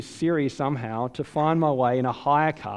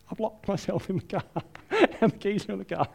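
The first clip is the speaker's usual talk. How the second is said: laughing; 5 semitones higher, faster than usual. Retold or imitated.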